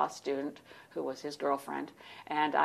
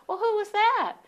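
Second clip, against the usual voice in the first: mock Arkansas Southern accent, pitched way up; 7 dB louder than usual. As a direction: play the friendly Arkansas tourists with a Southern accent. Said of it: "they had this soft Southern accent".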